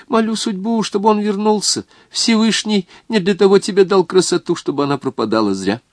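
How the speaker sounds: put-on high, tender old woman voice; kind old woman. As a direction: coo over her like a grandmother. Old woman welcomes the girl.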